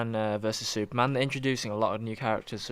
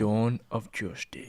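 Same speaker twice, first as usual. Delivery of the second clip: movie trailer voice; slower than usual. Pretend. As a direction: drag it out like a film trailer. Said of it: "Do it in your movie voice".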